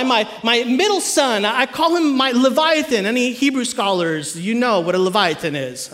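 ordinary talk

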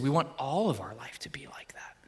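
whispered, soft and intimate. Retold or imitated. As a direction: breathe it like a private promise. Couple's vow retold.

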